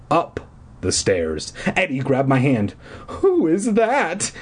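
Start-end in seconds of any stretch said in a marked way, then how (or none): none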